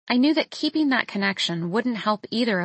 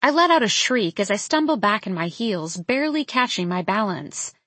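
usual speaking voice

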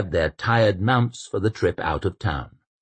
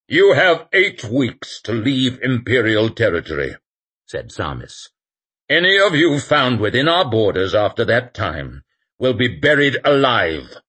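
stern legate; 4 semitones higher, 4 dB louder than usual. A narrator's reading. Legate's threat.

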